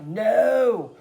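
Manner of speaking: loud, drawn-out "no"; retold dismayed cry. Fan reliving the team blowing a lead, dismayed cry. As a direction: wail it out in mock despair.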